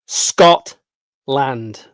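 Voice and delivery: "Scotland" split loudly into "Scot... land"; syllable by syllable. Shown as a demonstration. Vlogger teaching YouTubers to say "Scotland", slow and emphatic. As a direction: break "Scotland" in two with a round "o". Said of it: "It's Scotland".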